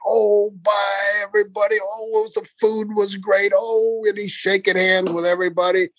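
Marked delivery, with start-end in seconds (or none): 0.1-4.0 schmoozing politician. Retold or imitated. Friend imitating Brian leaving the restaurant, schmoozing politician voice. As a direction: gush goodbyes like a glad-handing politician. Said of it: "he's such a politician".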